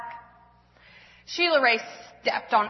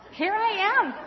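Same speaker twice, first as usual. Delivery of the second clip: answering voice; 9 semitones higher than usual. Acted. Sister's voice answering.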